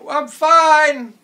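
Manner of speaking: high, loud shout from below; shouting back. Acted. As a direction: holler it up to someone far above. Calling up from the cave bottom.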